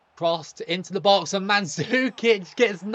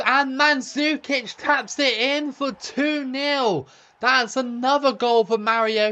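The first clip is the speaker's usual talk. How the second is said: excited goal call; 6 semitones higher than usual. Real, not acted. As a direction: sell the goal like a commentator. Goal scored in FIFA commentary.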